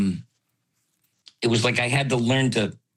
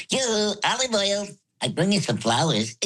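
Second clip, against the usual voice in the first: high Popeye voice, higher start; 10 semitones higher than usual. Shown as a demonstration. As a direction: squeeze out a Popeye voice. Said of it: "a high voice and a low voice at the same time".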